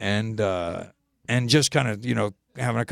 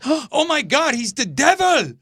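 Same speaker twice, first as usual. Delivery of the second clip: high, loud horrified cry; mock freak-out; 12 semitones higher, 5 dB louder, slower than usual. Singer seeing him walk into the hotel, mock horrified cry. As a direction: imitate a woman shrieking in alarm. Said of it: "she really freaked out".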